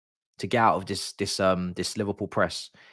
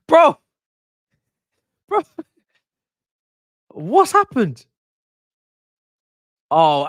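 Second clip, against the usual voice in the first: much higher and louder, furious disbelief; outraged protest; 7 semitones higher, 5 dB louder than usual. Real, not acted. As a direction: yell it at the screen, unable to believe it. Outrage at a goal decision.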